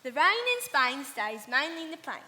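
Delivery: thick cockney, rain in Spain as "rine in Spine"; cockney model line. Shown as a demonstration. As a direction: say it the cockney way to teach her. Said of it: "Now, repeat after me".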